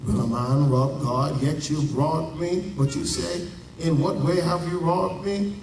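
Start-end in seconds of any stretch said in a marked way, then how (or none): none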